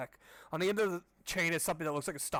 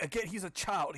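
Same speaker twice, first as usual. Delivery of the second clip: ordinary delivery, nothing marked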